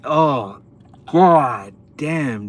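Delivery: disgusted exclamation. Real, not acted. Reacting to the gross scene.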